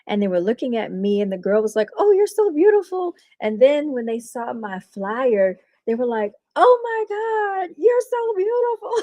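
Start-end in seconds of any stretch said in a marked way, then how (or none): 2.0-3.1 high-pitched imitation of an excited admirer; retold squeal of admiration; gushing excitement. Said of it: "they got all excited". 6.6-9.0 very high, drawn-out mock squeal; exaggerated high-pitched gushing; gushing excitement. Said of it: "they got all excited".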